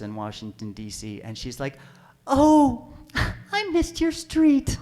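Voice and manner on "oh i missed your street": high, slow singsong midwife voice; mock her airy, unbothered tone up high; oblivious midwife.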